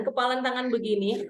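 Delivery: usual speaking voice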